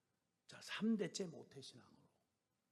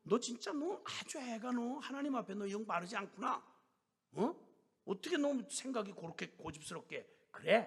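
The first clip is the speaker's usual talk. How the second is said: higher, pleading yet scolding; exasperated rebuke; 7 semitones higher than usual. Retold or imitated. Elder pressing the stubborn sister, exasperated. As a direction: press her hard, half pleading. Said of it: "안타까움을 넘어서".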